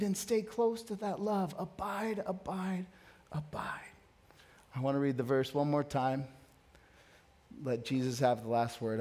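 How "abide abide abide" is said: each abide softer until only a whisper; fading to a whisper. Acted. Preacher lets the call to abide fade to a whisper. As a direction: let the word die away to breath.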